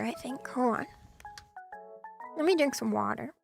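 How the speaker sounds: ordinary delivery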